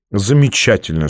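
ordinary delivery, nothing marked

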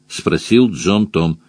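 usual speaking voice

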